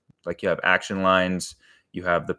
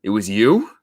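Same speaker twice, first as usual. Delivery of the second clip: louder, rising question; mock shocked accusation; 7 dB louder than usual. Grieving guy turns on his apologizing friend, mock shocked accusation.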